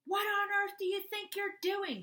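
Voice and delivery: high, loud shocked scolding; yelling mom. Acted. Mom catching her son breaking the window, angry yell. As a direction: yell it like a furious mother. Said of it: "she yelled".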